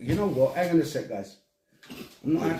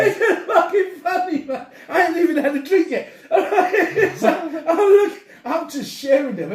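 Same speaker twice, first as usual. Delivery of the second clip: laughing; 11 semitones higher, 7 dB louder than usual. Real, not acted. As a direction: still cracking up, can barely get the words out. Still laughing at the joke.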